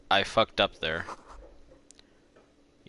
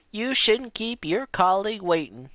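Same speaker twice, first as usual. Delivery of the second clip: imitating the lady, higher; 11 semitones higher than usual. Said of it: "That's a bit better".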